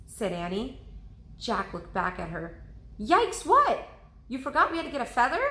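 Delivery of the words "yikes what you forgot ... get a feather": high, loud and fast alarmed question; startled question. Acted. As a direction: blurt it out in alarm. Jack startled by Annie.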